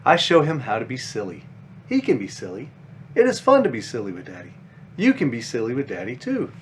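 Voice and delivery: put-on gentle, lively storybook read for kids; reading to children. A narrator's reading. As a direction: read it like a bedtime picture book. Reads children's book aloud.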